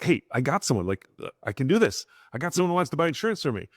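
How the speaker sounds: quick, keen burst as a retold quote; excited. Trainee excitedly telling staff about a first prospect, excited. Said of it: "they would come very excitedly".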